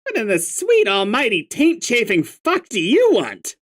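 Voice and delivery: angry troll. Acted. Grumpy troll answering the stoner, irritated snap. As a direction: bite every word out in irritation.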